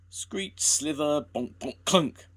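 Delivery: high loud sound-effect words; crash noises. A narrator's reading. Narrator acting out the engine skidding, noisy sound effects. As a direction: bang out the braking noises. Train braking into snow.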